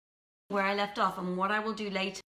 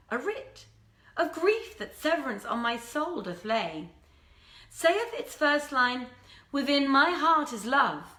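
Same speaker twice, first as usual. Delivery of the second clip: lively storyteller voice, lifted and expressive; story narration; 4 semitones higher, 3 dB louder, slower than usual. A narrator's reading. Storyteller reading Arabian Nights to a live audience, lively and expressive. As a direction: read the old tale with relish to the audience.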